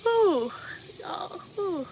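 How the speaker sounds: breathy whisper overcome with feeling; real emotion, whispered; emotional whispered ooh. Real, not acted. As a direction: exhale the words, moved almost to tears. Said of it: "very sensitive".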